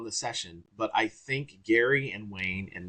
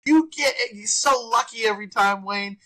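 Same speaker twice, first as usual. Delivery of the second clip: higher, louder teasing mock; loud crazy Gary; 11 semitones higher, 5 dB louder than usual. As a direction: rib your friend loudly. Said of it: "to be loud and crazy and funny".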